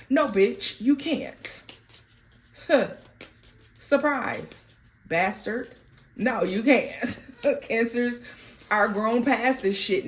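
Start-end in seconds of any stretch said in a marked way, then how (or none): 0.1-5.7 defiant retort. Real, not acted. Defiant reply to the ex. 6.2-7.6 laughing. Real, not acted.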